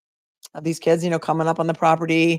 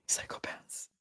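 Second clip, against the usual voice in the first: whispered; 18 dB quieter, slower than usual. Real, not acted. Muttered verdict on vandals.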